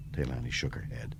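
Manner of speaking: ordinary delivery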